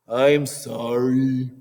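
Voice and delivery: slow, grudging mock apology; insincere sorry. Child apologizing to parents, grudging insincere sorry. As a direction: drag it out like you don't mean it. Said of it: "Your tone of voice says".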